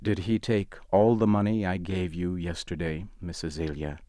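plain reading voice

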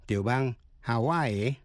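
usual speaking voice

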